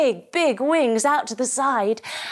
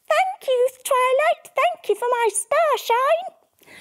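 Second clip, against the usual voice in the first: very high, slow squeaky mouse thanks; tiny mouse voice; 14 semitones higher, slower than usual. Acted.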